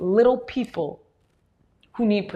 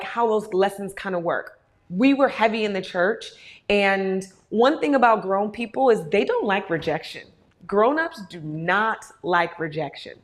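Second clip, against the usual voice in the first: ordinary delivery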